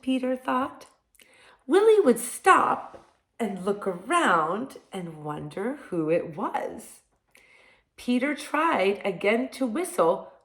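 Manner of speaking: lively storybook read-aloud; reading to children. Acted. Reading picture book to children.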